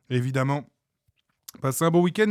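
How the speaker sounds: usual speaking voice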